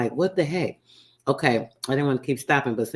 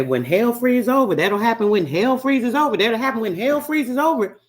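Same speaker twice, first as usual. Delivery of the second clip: put-on higher, louder, sing-song old folk saying; imitating the ancestors' saying; 9 semitones higher, 4 dB louder than usual. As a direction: recite it like the elders used to. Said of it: "We hear our ancestors say".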